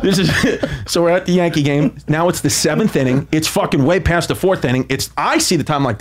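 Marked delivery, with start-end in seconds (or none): none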